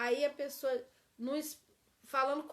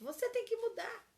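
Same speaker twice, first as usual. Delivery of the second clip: much higher, blaming command; accusing demand; 9 semitones higher than usual. Shown as a demonstration. Teacher contrasts accusation with confession, high blaming tone. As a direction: hurl it as an accusation. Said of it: "Olha como é diferente".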